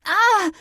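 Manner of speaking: high, loud shriek of pain; a sudden pained shriek; startled shriek from a nail stab; sharp shriek. A narrator's reading. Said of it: "I shrieked".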